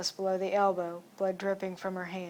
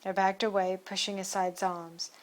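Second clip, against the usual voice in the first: usual speaking voice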